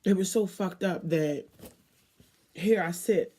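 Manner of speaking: ordinary delivery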